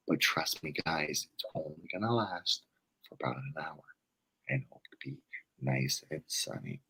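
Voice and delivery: hushed forecaster imitation, low and fading to a whisper; super quiet. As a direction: answer the co-host as if not to wake anyone. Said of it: "That's how he talks".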